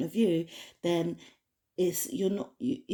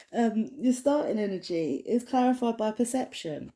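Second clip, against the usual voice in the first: ordinary talk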